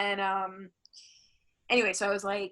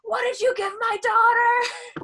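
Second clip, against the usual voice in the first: shrill, much higher, louder frantic mother; angry mom; 13 semitones higher, 8 dB louder than usual. Retold or imitated. Mother at the hospital accusing her, shrill and much higher. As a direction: shriek it like a furious mom. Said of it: "her mom showed up and was like".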